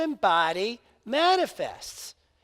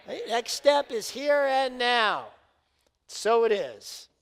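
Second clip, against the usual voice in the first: prayer; 3 semitones higher, 6 dB louder than usual. Acted. Spectator dodges with a prayer.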